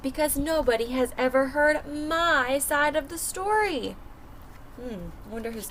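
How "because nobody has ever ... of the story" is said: put-on lively first-person wolf narrator for kids; storybook read-aloud. A narrator's reading. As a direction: tell it as the wolf, reading to a class. Reads the wolf's story aloud.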